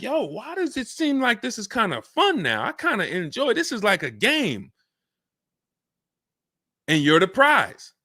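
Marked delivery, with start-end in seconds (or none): 0.0-4.7 higher-pitched, lilting mock delight; put-on higher voice, pleasantly puzzled; pleasantly surprised buyer. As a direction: act out a customer finding it fun. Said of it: "you're gonna be like".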